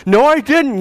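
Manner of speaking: high, loud insistence on the phone; a retold shouting match, flung back hard; arguing with the rep, high and loud; heated denial. Quarrel, his own reply.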